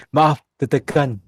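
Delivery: slow, loud prim apology; mock polite girl voice. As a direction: say it primly and slowly.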